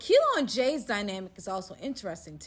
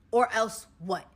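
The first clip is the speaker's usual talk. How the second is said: mock threat; 8 dB louder than usual. Kilo repeats threat louder.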